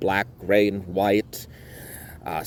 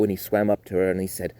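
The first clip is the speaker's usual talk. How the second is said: ordinary delivery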